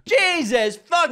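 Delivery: high laughing exclamation. Real, not acted. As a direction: burst out laughing.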